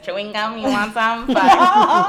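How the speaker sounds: cracking up while ranting to a friend; laughing. Real, not acted. Laughing at the cops to a friend, cracking up.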